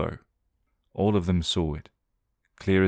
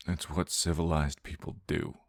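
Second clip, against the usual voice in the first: hoarse; 7 dB quieter than usual. Acted. Johnny answering his sister, soft and hoarse. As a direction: force it out through a dry throat. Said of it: "Johnny's voice was hoarse".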